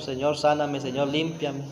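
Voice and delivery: queja murmurada. Retold or imitated. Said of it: "así le decimos a veces al Señor".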